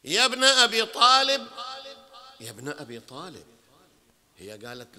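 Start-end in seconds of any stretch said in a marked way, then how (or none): 0.0-1.5 a full-voiced call to the crowd; loud calling out. Shown as a demonstration. Loud call then soft repeat. 2.4-3.5 soft repeat. Shown as a demonstration. Soft repeat after loud call.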